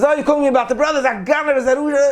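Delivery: high, fast, irritated brother on the phone; annoyed brother. Retold or imitated. Bitter brother picking up the rabbi's call, irritated and high. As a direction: snap at the caller like a fed-up brother.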